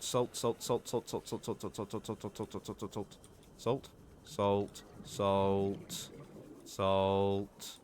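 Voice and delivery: fading, groaning zombie chant; salt zombie. Acted. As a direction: drone the word like a zombie. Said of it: "I sound like a fucking salt zombie".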